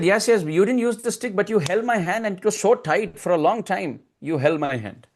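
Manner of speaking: lightly voiced little boy accusing his mother; Krishna. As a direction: pipe up with a child's grievance. Child Krishna complaining to his mother.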